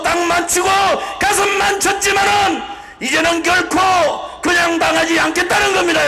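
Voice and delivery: full-throated rally cry building up; roaring rallying speech. Real, not acted. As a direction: rouse the crowd at full force.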